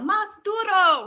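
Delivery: high, slow shouted cheer; yelled in Spanish. Retold or imitated. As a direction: shout it out like the crowd. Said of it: "everyone who spoke Spanish would yell".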